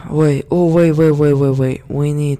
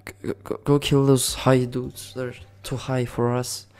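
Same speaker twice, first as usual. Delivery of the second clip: normal talking voice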